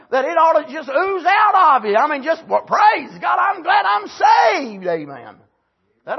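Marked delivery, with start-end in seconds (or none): 2.6-4.9 slow, high, shouted praise; joyful shout. Acted. Preacher exulting before the church, shouting joyfully. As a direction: cry it out in rapture.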